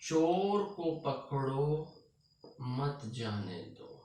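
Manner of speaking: slow, drawn-out dictation; dictation pace. Shown as a demonstration. Teacher writing a sentence on the board, slow dictation. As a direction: say it slowly, stretching words as you write. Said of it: "यहाँ पर मैं लिखता हूँ".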